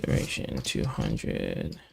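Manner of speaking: ordinary delivery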